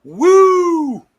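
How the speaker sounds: a high, loud wrestler's woo; Ric Flair woo. Retold or imitated. Celebrating a right answer with a Ric Flair woo. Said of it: "Ric Flair".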